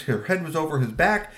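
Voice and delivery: plain reading voice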